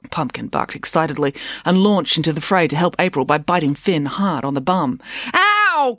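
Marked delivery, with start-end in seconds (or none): 5.3-5.9 loud, high "ow"; pained cry. A narrator's reading. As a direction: yelp as if bitten. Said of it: "cried Finn".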